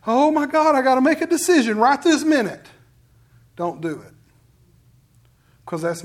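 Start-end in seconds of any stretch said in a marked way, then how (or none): 0.0-2.7 high, loud, hurried inner panic; panicked rush. Acted. Preacher voicing a listener's inner urge to rush, panicked. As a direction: act out frantic pressure to decide. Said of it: "especially if you get that sense inside of you".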